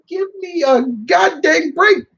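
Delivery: high-pitched mock exasperation; exasperated. Gamer fed up with Bowser, high exasperated outburst.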